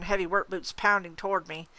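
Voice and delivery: plain reading voice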